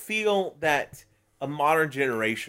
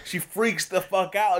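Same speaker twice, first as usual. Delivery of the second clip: ordinary talk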